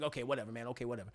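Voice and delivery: low, soft, dismissive mumble; acted sulky acceptance under the breath; grudging compliance. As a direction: give in quietly, trailing away.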